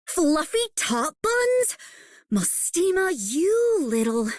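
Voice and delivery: high, drawn-out indignant exclamation; indignant outburst. Acted. Character scolds Mostima over a silly nickname, indignant. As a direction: draw out the words in high-pitched outrage.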